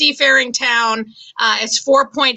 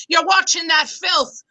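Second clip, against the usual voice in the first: scolding grandmother voice, a bit higher; 4 semitones higher than usual. Retold or imitated. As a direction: scold the TV like a prudish gran. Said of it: "she goes".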